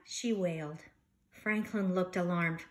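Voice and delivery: lively, expressive storybook reading; reading to children. Acted. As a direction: read it like story time for a class. Teacher reads picture book aloud.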